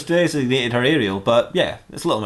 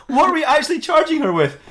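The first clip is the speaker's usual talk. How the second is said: hammed-up incredulous boss, pitched way up; exasperated disbelief; 12 semitones higher than usual. Detective disputing the mermaid's arrest, exasperated.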